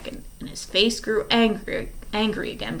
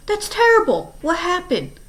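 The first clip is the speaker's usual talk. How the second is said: shocked; 11 semitones higher, 5 dB louder than usual. Acted. Poe hears of the death.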